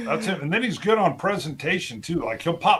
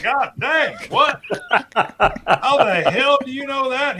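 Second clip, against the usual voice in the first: amazed disbelief; 8 semitones higher, 6 dB louder, slower than usual. Retold or imitated. Amazed retort to friend's recall.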